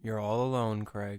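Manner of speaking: low, disembodied inner voice; voice of consciousness. Pretend. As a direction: speak low as his inner voice. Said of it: "it's the voice of your consciousness".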